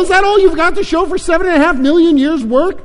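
high, loud tirade; outraged yell. A narrator's reading. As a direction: yell your indignation at the computer. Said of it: "yelled Lun Kuo".

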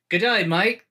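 high, tentative mock Australian; Australian accent. Companion asks if this is down under talk, Australian accent. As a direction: try out an Australian greeting accent. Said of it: "talk like you're from down under".

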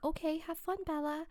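softly sing-song mock farewell; friendly goodbye. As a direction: lightly voice the nice girlfriends. Friends' polite goodbye acted out.

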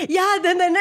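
high laughing mock boom; high, laughing yeah then then; imitated loud reply. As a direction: play his loud cheerful answer. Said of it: "And then he goes".

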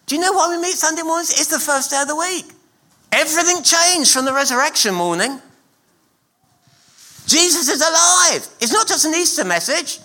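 full-force preaching. Real, not acted. Preacher proclaiming the resurrection to the church, at full force. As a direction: fire up the congregation.

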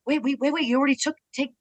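high, flustered, hurried objection; retold half-joking wait wait; playful protest. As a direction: stop them with mock alarm. Retold protest at fans taking moves.

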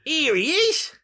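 high, loud hammed-up hail; comic holler, drawn out; mock greeting. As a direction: hail an imaginary mate, voice way up. Greeting an invented nickname, Roy.

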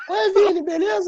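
squeaky high-pitched greeting; chipmunk voice. Pretend. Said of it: "fala geral com voz fina".

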